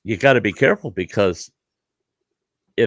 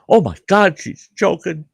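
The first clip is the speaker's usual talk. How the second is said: higher, frantic imitation of a friend; panicked buddy; 8 semitones higher than usual. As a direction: play the helpless friend in a panic. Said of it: "my buddy's going".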